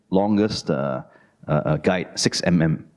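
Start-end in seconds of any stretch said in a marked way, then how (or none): none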